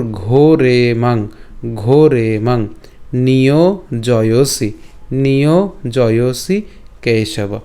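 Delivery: slow ঘোরে মাং and নিয়োজয়সি, long vowels held; measured Sanskrit syllables, one phrase at a time; pronunciation demo. Shown as a demonstration. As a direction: repeat each phrase slowly for learners. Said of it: "আবার প্রথম থেকে বলি".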